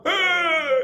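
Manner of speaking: high wailing ah; imitating a weeping crowd; mock wail. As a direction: wail like mourners. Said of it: "There's people going".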